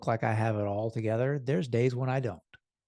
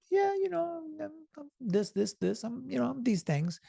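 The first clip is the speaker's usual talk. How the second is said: much higher, fumbling self-mocking voice; mocking his own hesitation; 12 semitones higher, 4 dB quieter than usual. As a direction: play your own unsure, embarrassed self.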